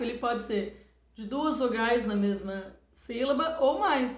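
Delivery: ordinary talk, nothing marked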